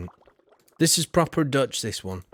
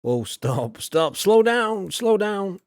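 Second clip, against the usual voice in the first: calling out; 7 semitones higher, 4 dB louder, slower than usual. Real, not acted. Telling the reader to slow down.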